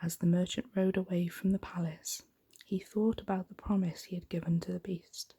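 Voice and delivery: plain reading voice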